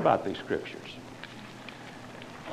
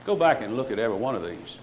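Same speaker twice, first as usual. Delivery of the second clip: usual speaking voice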